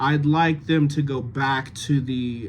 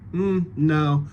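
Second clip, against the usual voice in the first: ordinary talk, nothing marked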